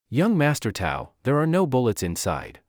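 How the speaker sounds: plain reading voice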